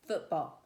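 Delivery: football with two strong beats; spondee stress. Shown as a demonstration. Teacher showing students a spondee, football evenly stressed on both halves. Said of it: "is stressed, stressed".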